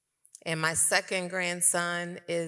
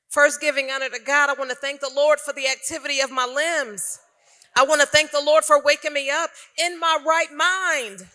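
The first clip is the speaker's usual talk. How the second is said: higher, loud preacher's call of thanks; preaching praise; 7 semitones higher, 9 dB louder than usual. Acted. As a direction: lift it up like a church opener. Church-style thanksgiving opening.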